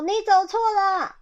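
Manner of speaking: storybook dog shouting directions; dog calling out. Acted. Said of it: "然后狗狗就一直讲说".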